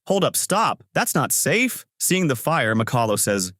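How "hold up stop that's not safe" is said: high-pitched warning, only mildly urgent; calling out. A narrator's reading. Ivan warns McCullough as she runs toward the blast, calling out. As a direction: read a call to stop, pitched well up. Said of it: "Ivan calls out".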